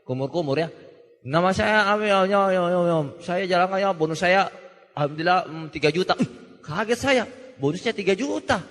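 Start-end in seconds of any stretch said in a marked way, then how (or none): 1.2-6.2 garbled gargling-mouth speech; imitated mumbling. Top earner introducing himself and his bonus, imitated mumbling. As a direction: run the words together as if gargling, just barely intelligible. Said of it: "Nama saya ya kumur-kumur ya, tapi kedengaran juga ya".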